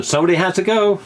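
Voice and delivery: high mock insistence, retold; a hint of a stubborn actor; imitating Jamie. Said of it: "He goes".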